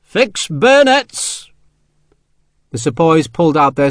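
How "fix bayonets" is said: very slow, high, drawn-out command; long parade-ground order; barked order. A narrator's reading. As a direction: stretch each word across the parade ground. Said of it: "growled an order to his company".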